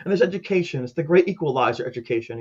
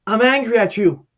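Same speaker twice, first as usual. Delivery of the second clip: raised, louder accusation; retold angry confrontation; 4 semitones higher, 5 dB louder than usual. Bereaved mother confronting caseworker at church, raised angry accusation. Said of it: "She goes".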